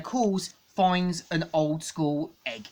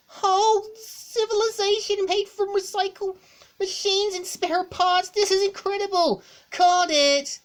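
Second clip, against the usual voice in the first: much higher, excited fanboy gush; Tails geeking out; 4 dB louder than usual. Acted. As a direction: gush like a thrilled tech nerd. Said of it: "Tails geek out in three, two, one".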